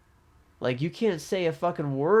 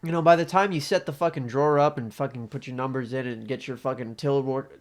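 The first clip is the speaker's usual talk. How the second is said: ordinary talk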